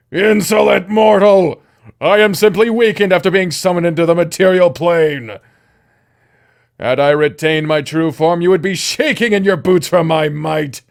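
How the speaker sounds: loud, grand demon voice; pompous demon snake. Acted. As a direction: boom it out like a vain demon.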